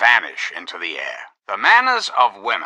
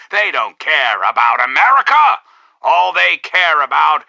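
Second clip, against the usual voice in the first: loud, emphatic denunciation; indignant outburst; 3 semitones higher, 5 dB louder than usual. Acted. Orator telling America the radicals don't care, indignant outburst. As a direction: build to a ringing cry on the last word.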